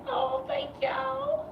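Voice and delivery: way up high and slow, mock sweet; squeaky falsetto thanks. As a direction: thank them in a tiny high voice. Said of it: "and I'll be like".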